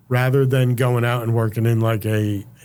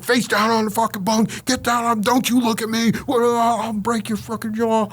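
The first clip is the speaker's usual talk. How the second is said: high, mock-aggressive cop voice, pitched way up; cops barking orders; 12 semitones higher than usual. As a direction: snap orders like a raiding guard. Cops raiding cell, shouting orders.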